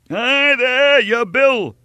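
very high, loud hail; shouting. A narrator's reading. Innkeeper to the departing guest, loud shout. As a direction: yell after a fleeing guest. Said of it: "shouted the innkeeper".

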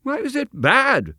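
a touch of startled confusion; blinking surprise; mildly astonished. Acted. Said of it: "in mild astonishment".

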